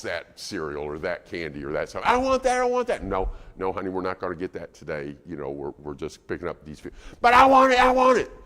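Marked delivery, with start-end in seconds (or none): 2.0-3.0 put-on high whining kid demanding; whiny child. Toddler in the buggy seat begging mom, high whine. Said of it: "it'll go kinda like this". 3.0-6.9 soft, quick placating mom voice; mock soothing mom. Mom in the store calming her child, soft and quick. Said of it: "it'll go kinda like this". 7.2-8.3 high, loud tantrum wail; screaming child. Retold or imitated. Toddler in the store screaming at mom, high loud wail. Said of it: "he gets louder and louder".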